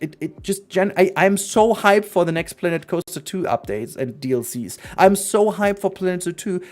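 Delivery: ordinary delivery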